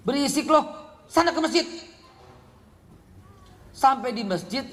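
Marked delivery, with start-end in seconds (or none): 0.1-1.7 hammed-up father chasing the kid out; annoyed father. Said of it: "Akhirnya bapaknya bilang".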